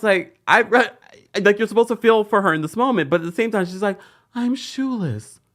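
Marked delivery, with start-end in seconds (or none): 4.3-5.4 high, slow mock-pitiful whine; imitated emotional whine. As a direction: draw it out pitifully, much higher pitched. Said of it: "Monica is still just so emotional".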